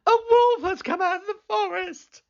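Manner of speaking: high, fast, urgent alarm; high, fast false-alarm call; alarmed shout. Acted. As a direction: rush it out in mock panic. Boy raising false alarm.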